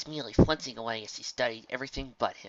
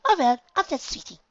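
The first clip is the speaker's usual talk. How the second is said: Rarity voice; 8 dB louder than usual. A narrator's reading. Rarity leaves lightly.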